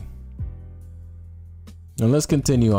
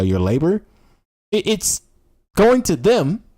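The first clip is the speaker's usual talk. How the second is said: normal talking voice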